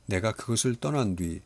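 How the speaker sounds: ordinary delivery, nothing marked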